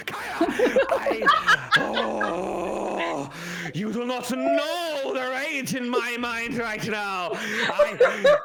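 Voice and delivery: high, loud frustrated shout; enraged mother. Acted. Mother shouts her rage at Kaya, high and loud.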